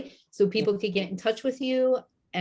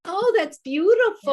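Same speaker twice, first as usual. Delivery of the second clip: high delighted gasp of praise; excited; 13 semitones higher, 8 dB louder than usual. Real, not acted. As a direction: gush with real delight. Delighted reaction to a shout-out.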